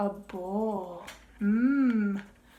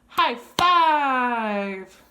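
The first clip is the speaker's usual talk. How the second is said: louder playful cheer; 7 dB louder than usual. Shown as a demonstration. High five to baby hands.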